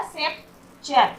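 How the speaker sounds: "सेप्चर" slowly split as sep, pause, cher; "सेप्चर" drawn out syllable by syllable; broken into syllables. Shown as a demonstration. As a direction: say "सेप्चर" in two separated parts. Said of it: "सी का sound नहीं हो रहा".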